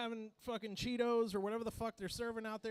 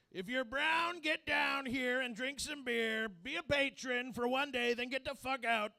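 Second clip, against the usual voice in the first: lightly voiced Trump making a proclamation; Donald Trump impression; 3 semitones higher, 4 dB louder than usual. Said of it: "what if we had Donald Trump in this room".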